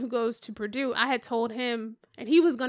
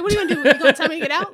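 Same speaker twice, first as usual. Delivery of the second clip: loud, higher-pitched mock challenge; amused, raised-voice bravado; laughing defiance; 5 semitones higher, 10 dB louder, much faster than usual. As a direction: throw it out like a dare. Retold defiance, amid laughter.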